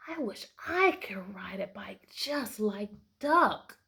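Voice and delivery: slow, whispered wish; whispered thought. Acted. Mouse's secret thought.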